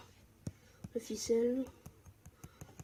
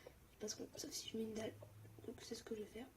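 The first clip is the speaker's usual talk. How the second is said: softer whispered planning; 17 dB quieter, faster than usual. Real, not acted. Gamer whispers his plan to viewers, hushed. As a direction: murmur the plan under your breath. Whispering plans while mining.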